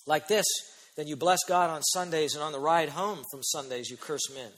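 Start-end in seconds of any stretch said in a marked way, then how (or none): none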